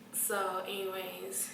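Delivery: ordinary delivery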